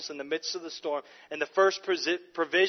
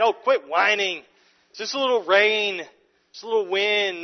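dismissive scoff; 4 semitones higher, 8 dB louder than usual. Retold or imitated. Preacher mocks the voice that belittles the storm, loud scoff. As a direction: scoff at their fear as if it were nothing.

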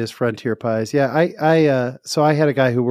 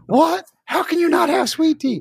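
very high squeal of disbelief; outraged disbelief. Retold or imitated. Southern coworker told there's no sweet tea, high outraged squeal.

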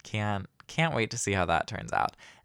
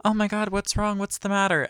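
much higher, imitating a worried mom's rush of questions; 12 semitones higher than usual. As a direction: play the alarmed mother bursting in. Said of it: "and she's like".

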